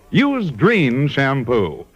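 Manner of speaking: normal talking voice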